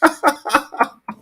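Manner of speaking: high falling laugh. Real, not acted. Host still laughing at the clip. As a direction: let a high laugh fall away.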